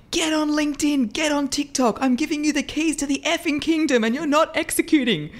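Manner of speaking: high, pushy motivational pep talk; imitating Gary Vee. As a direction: fire it off like a hype-man guru. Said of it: "where he said".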